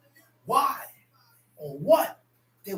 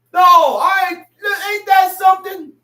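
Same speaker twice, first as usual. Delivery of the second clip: louder shouted refrain; loud exclaimed ain't that something; preaching shout; 3 semitones higher, 8 dB louder than usual. Acted. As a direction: belt it to the congregation.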